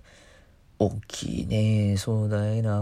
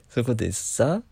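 usual speaking voice

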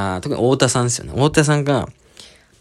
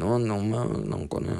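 soft mumbled grumble, much softer; mumbling; 3 semitones lower, 9 dB quieter than usual. Retold or imitated. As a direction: mumble it under your breath. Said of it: "ボソボソボソボソなんか言って". (ぼそぼそと小声でつぶやく)

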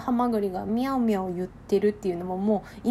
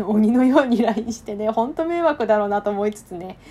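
laughing; 8 dB louder than usual. Real, not acted. Laughing about pestering her senior.

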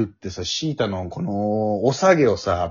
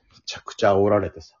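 ordinary talk, nothing marked

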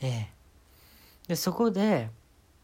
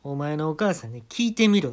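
louder, curt and rude order; blunt casual command; 2 semitones higher, 6 dB louder than usual. Acted. Comic tells manager to ask his mother, rudely. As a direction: talk down to the manager. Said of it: "タメ口で".

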